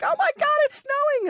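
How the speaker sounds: very high, quick, loud squeal; excited squeal. Retold or imitated. Retelling her first snow in Canada, a very high excited squeal. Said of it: "being like".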